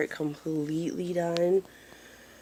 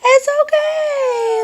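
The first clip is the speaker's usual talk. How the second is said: very high, loud, slow sing-song; stretched high cooing reassurance; 8 dB louder, slower than usual. Real, not acted. Soothing a fussing baby.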